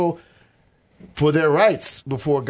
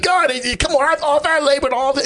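whiny Cain; 11 semitones higher than usual. Retold or imitated. Cain complaining to God.

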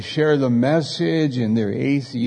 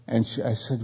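usual speaking voice

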